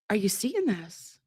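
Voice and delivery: normal talking voice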